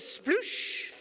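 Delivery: drawn-out whooshing "sploosh"; imitated splash. Storyteller imitating the lion hitting water, drawn-out splash. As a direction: make a long watery splash noise.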